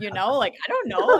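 laughing it off, pitch jumping way up; a real amused laugh cutting in. Real, not acted. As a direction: giggle through the last words, teasing. Laughs at her own jab.

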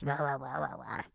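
a low, soft mock troll growl; troll voice demo. Shown as a demonstration. Mom showing daughter what her troll voice sounded like, soft mock growl. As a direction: lightly imitate a troll's rah rah. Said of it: "when you talked like this".

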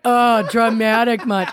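high, loud, drawn-out teasing; slow, exaggerated sarcastic jab; mocking. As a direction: tease someone for being overdramatic. Teasing her emotional mother.

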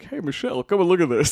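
loud, high-pitched comic impression; Obama. Host re-enacting a bit from an old episode, loud comic Obama. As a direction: call out as a hammed-up president. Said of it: "the Obama impressions".

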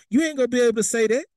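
quick high teasing call; high playful jab. Acted. Teasing the congregation, quick high jab. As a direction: tease them up high and fast.

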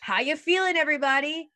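high hype-man call to the crowd; concert artist hype. Retold or imitated. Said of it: "the artists have to go".

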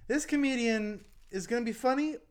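mock host intro. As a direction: hype the act, then undercut it. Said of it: "the host will be like".

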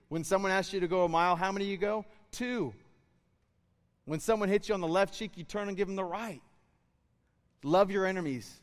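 rally the room like a revival preacher; fiery preaching. Acted. Pastor urging believers to love their enemies, fiery preaching.

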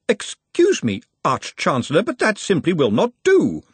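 the nervous clerk edging a question out; fretful junior, pitched well up; anxious young wizard. Acted. Ponder voiced in audiobook.

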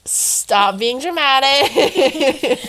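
loud, drawn-out mock scold; teasing scold. Real, not acted. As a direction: tell her off, laughing. Teasing friend's fake tears.

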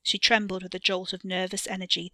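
ordinary delivery, nothing marked

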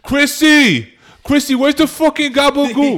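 loud, high Jersey mob voice; Tony Soprano impression. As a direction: yell like a Jersey mob boss. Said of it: "his friends".

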